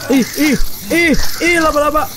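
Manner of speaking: high squeal of disgust. Real, not acted. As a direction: squeal at the spider. Spider scares the player.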